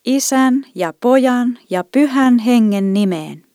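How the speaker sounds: loud, high, slow solemn intoning; priest's loud solemn blessing at the start of service; booming blessing. A narrator's reading. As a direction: proclaim it slowly so the church rings. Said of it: "Ääni kuului niin isosti".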